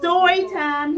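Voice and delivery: loud, high call of story time; shouted. A narrator's reading. Eddie calling everyone to story time, shouting. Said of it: "he shouted".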